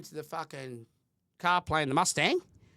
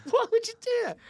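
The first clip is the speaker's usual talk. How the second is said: laughing disbelief; 4 dB louder, faster than usual. Real, not acted.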